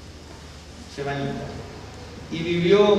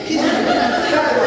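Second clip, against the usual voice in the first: laughing; 7 semitones higher, much slower than usual. Real, not acted. Laughing at own story.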